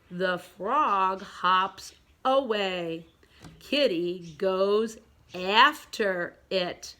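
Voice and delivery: evenly drawn-out words; slow read-along. Shown as a demonstration. Teacher leads pupils through picture three, slowly. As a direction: read word by word for beginners.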